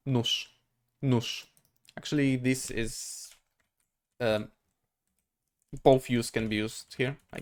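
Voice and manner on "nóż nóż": "Nóż" ending in a voiceless sh; softer final consonant. Shown as a demonstration. Teacher applying the rule to knife, devoiced ending. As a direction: say "nóż" as noosh. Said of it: "the softer version at the end of the word".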